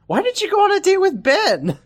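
mocking mom voice. Mother scolding daughter about Ben, squeaky high mock voice. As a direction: pitch it up like a nagging cartoon mother.

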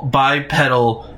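usual speaking voice

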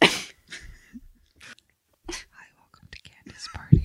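soft unvoiced whispered welcome; whispered podcast welcome. Whispered intro take.

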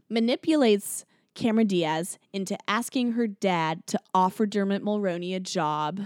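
ordinary talk, nothing marked

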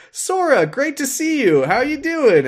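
high, loud mock cheerful friends; cheery friends. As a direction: bounce up and greet him eagerly. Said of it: "they're like".

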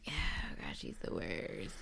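very quiet, breath-only whisper; whispered dismay. Real, not acted. Disgusted aside about the contestant.

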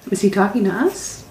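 ordinary talk, nothing marked

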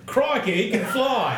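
high, laughing Aussie voice; Australian accent. Pretend. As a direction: ham up a Crocodile Hunter accent. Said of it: "my Australian accent".

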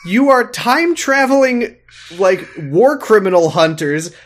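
high-pitched mock exasperation; worked-up, exasperated rant; exasperated scolding. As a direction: scold them in mock disbelief. Mock scolding of time travelers.

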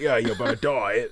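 sling it out like a cheeky Cockney geezer; joking Cockney threat; bad Cockney accent. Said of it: "he's a lot better than this accent".

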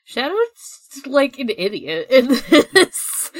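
laughing. Real, not acted. Laughing while mocking Shadow.

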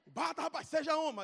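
high, pushy command; lightly voiced tough older kid; bossy order. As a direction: urge him on roughly. Corró orders him to hit.

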